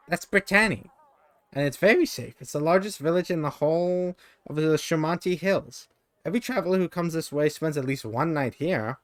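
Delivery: high, bright little sprite voice; chirpy little creature. Acted. Narrator voicing a tiny mite greeting the traveler, squeaky. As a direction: pipe it up like a tiny fairy creature.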